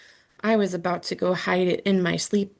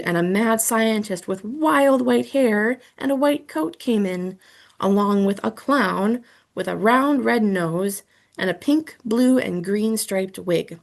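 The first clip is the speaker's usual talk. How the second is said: plain reading voice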